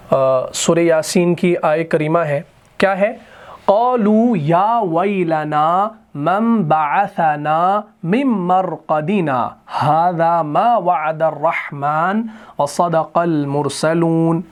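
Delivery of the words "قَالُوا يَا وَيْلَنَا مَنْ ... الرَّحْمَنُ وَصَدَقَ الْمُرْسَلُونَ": slow chanted tajweed, vowels drawn long; Quran recitation. Shown as a demonstration. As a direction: recite the ayah in a melodic chant. Reciting the ayah.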